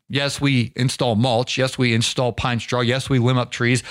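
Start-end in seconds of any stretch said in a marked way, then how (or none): none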